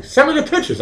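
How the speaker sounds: mock high squeal. Mock flirty request to chat.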